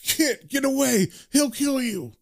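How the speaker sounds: high-pitched urgent warning; acted anxious plea; instantly worried. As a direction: warn her away in alarm. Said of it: "instantly worried for Kit".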